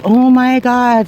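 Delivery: slow, high shocked "oh my God"; drawn-out disbelief; stunned gasp. Retold or imitated. Shock after waking from faint.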